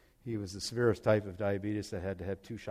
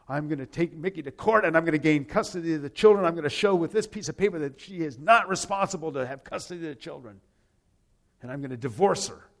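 determined vow; 5 semitones higher, 8 dB louder than usual. Retold or imitated. Uncle vows to fight in court.

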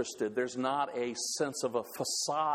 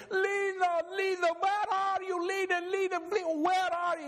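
Ole screaming. Acted. Ole jumping up and down, screaming for Lena. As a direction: shout for her across town, pitched way up. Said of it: "he's screaming".

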